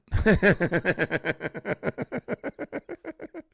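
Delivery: long high giggling laugh; gleeful laugh. Real, not acted. Streamer laughing at Kevin still watching, long high laugh. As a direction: crack up at the viewer's hypocrisy.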